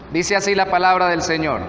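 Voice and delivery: ordinary delivery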